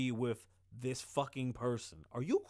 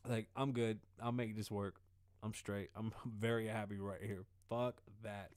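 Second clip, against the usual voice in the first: softer, lower, settling into it; quiet vow; 6 semitones lower, 6 dB quieter than usual. Real, not acted. Promising himself to stay, said softly and low. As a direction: drop down and say it to yourself. Vows he's content at home.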